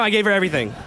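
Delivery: ordinary talk, nothing marked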